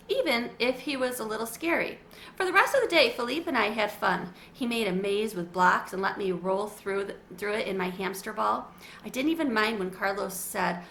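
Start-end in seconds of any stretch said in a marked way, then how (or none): none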